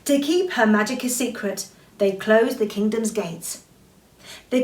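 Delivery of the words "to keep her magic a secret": ordinary delivery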